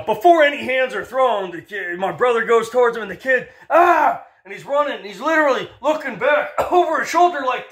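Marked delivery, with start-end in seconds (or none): 3.7-4.2 startled yelp. Retold or imitated. Kid flees the brother.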